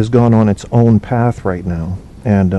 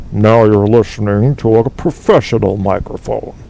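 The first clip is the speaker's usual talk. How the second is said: mock professional-microphone voice; imitating a caller showing off his pro mic; Apocalypse. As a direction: put on a big radio-announcer voice. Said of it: "when he calls in, it's like".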